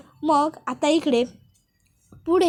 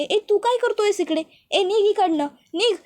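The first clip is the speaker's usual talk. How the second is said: higher, sharp mock telling-off; angry dismissal; 7 semitones higher than usual. Pooja orders Jaykant out of the dark room, acted anger. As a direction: push him away with the voice, acted.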